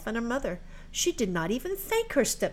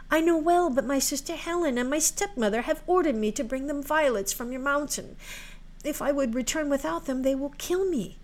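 put-on higher-pitched, earnest girl voice; pleading maiden; 6 semitones higher than usual. Maiden explains her stepmother's orders to January, pleading. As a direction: plead as a frightened young maiden.

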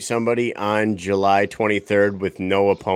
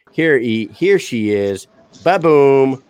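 announcer hype; 4 semitones higher, much slower than usual. Acted. Hyped entrance introducing fighter.

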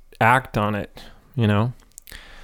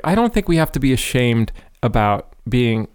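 ordinary talk, nothing marked

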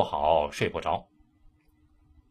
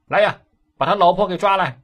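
high, loud commanding bellow; an official barking at his men; magistrate's shouted order; 10 semitones higher, 10 dB louder than usual. Acted. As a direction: roar the order down the hall. Magistrate orders the wife seized.

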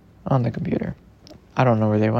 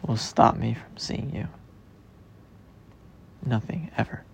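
whispered promise; 4 semitones lower, 5 dB quieter than usual. Real, not acted.

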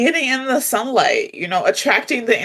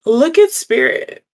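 awed wow; 4 semitones higher than usual. Retold or imitated. Awed quote on seeing butterfly.

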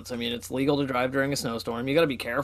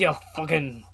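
put-on Scottish accent. Co-host trading mock threats, put-on Scottish accent. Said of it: "do the right Scottish accent".

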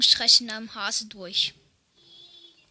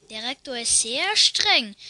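ordinary talk

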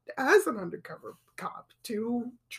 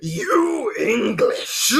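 slow drawn-out shouted taunt; wrestling promo; 8 dB louder, much slower than usual. Retold or imitated. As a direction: bellow it slowly at the crowd. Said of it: "cuts a promo on the English crowd".

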